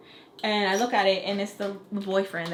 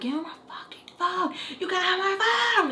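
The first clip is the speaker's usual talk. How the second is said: mock scream; 9 semitones higher than usual. Fighting cousin for her phone, mock frantic scream. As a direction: squeal it frantically without real volume. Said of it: "I'm, like, screaming, going crazy stupid".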